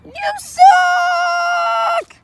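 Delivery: very high, loud squeaky shout; squeaky yell. Acted. Creature shouting back at the visitor in a squeaky high yell.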